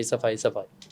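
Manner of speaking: a soft fading echo of सफाई, सफाई; fading repetition. Real, not acted. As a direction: let सफाई die away to a murmur. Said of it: "मुझे सिर्फ हर जगह पे सफाई चाहिए".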